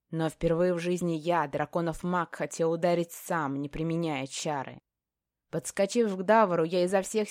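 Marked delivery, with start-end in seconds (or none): none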